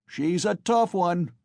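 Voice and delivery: high, drawn-out onlooker's call; crowd shout. A narrator's reading. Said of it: "was shouted in the crowd".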